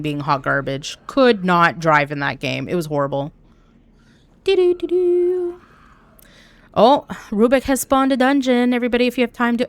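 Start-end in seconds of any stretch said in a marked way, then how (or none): 4.4-5.6 high, drawn-out doo-doo-doo; sung alert jingle. Real, not acted. Streamer sounding an alert to viewers, high sung jingle. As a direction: sing out a little high notification tune.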